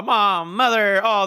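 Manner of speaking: normal talking voice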